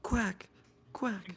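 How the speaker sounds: high-pitched mock quacking; short, high duck calls. Players trade duck quacks.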